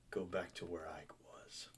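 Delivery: low, hushed whisper; menacing whisper. Acted. Captain to the pirate he has just run through, low whisper. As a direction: whisper it low to a dying foe.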